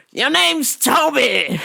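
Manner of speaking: slow, high mock master voice; hammed-up slave master naming him Toby. Customer as slave master, hammed-up high naming. Acting out Roots scene.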